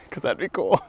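breaks into a high giggle; giddy little laugh. Real, not acted. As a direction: laugh it off with delight. Excited laugh after cool idea.

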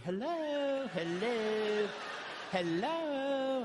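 high, very perky singsong hello; real perky. Retold or imitated. Wife answering a stranger's call, hammed-up perky. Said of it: "you're real perky".

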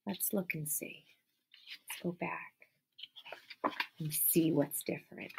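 slow, secretive whisper; suspense whisper. Acted. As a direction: draw the kids in with a slow whisper. Building suspense for kids.

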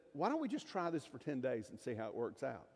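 quiet, unruffled negotiator; calm suggestion. Acted. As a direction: propose it calmly, voice dropped. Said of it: "he very calmly said".

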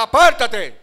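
loud, high shouted command; reprensión enérgica. Acted. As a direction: bellow it to drive the devil away. Acts a forceful rebuke of devil. (orden gritada, fuerte y aguda)

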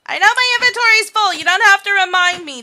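loud, high, fast frustrated yell; exasperated shout. Real, not acted. Gamer yelling at the inventory warning, exasperated. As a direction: snap back at the nagging game.

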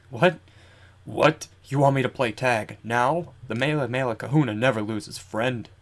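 put-on old man with a weird accent. Old Kahuna challenged to play tag, odd-accented old man. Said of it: "that weird accent along with his old man voice".

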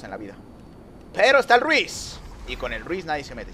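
ordinary talk, nothing marked

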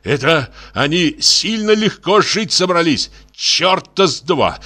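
high-pitched indignant rant; worked-up father railing at the young; flaring up. A narrator's reading. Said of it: "взвился опять отец".

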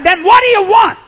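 high, loud exasperated demand; shouted retort. Retold or imitated. Preacher to the man in his line, exasperated shout. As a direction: throw the question back loudly.

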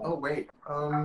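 slow, soft hesitation, searching for words; acting stuck. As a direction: imitate a speaker stalling mid-sentence. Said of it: "แบบ stuck อะไรอย่างเงี้ย". (แกล้งพูดติดขัด ช้าและเบา เหมือนนึกคำไม่ออก)

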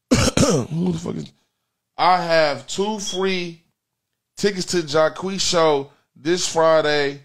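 ordinary talk

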